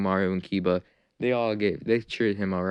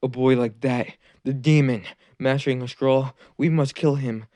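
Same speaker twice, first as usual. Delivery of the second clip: louder, higher angry mob voice; villagers fearful rage; 3 semitones higher, 5 dB louder than usual. Acted. Villagers cry out to kill Naruto, rising anger. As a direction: villagers demanding the boy die. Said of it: "They're like".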